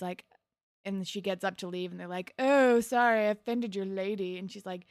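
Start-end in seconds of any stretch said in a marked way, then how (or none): 2.4-4.4 retold jeering fake apology; mock apology. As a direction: imitate guys teasing with a fake sorry. Retelling guys mocking her.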